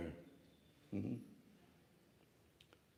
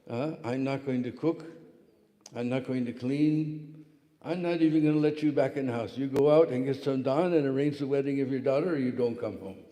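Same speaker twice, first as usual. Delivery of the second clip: louder scolding ultimatum, building; angry wife ultimatum; 2 semitones higher, 7 dB louder than usual. Retold or imitated. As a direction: lay down the law to your husband. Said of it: "One day she said".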